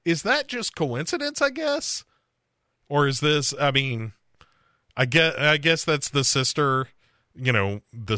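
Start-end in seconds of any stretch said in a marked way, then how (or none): 0.0-2.0 high, doubtful musing; a high, quizzical shrug in the voice; puzzled wondering. Retold or imitated. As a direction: wonder aloud, unsure and pitched way up. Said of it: "that I'm like".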